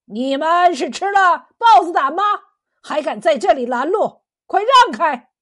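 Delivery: loud, high-pitched guard's demand; imperious bellowing; angry shouted challenge. A narrator's reading. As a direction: yell at them to clear the way. Guards shouting at fishermen blocking way.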